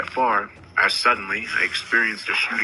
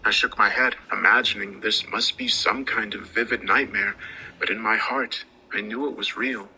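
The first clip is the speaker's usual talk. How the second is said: usual speaking voice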